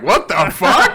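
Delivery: loud, high, rising, with laughter; appalled disbelief. Retold or imitated. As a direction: exclaim it half laughing in shock. His appalled reaction, laughing.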